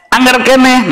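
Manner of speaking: the father loses patience, voice climbing. Retold or imitated. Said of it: "naik mah bahasana".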